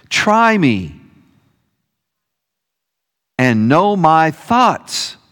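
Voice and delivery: slow, phrase-by-phrase prayer lead; leading a prayer line by line, with pauses; short phrases with pauses. Shown as a demonstration. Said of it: "I'm gonna lead us in a prayer time, phrase by phrase".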